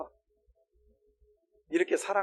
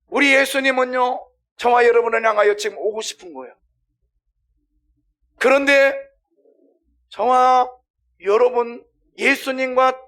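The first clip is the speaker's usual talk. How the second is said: loud, raised-pitch exhortation; forceful preaching; 7 semitones higher, 10 dB louder than usual. Acted. Preacher urging listeners to remember Jesus wants to come, forceful preaching. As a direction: preach it out to the whole congregation.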